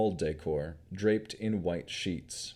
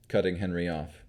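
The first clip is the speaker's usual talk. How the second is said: plain reading voice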